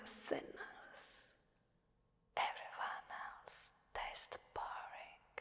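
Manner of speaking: pure breathy whisper; disdainful whisper. Acted. Krampus disdains dull prey.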